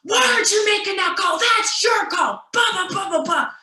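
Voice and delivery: high, loud retold yell; yelling coach. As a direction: shout the blame right in her face. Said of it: "he starts yelling in my face saying".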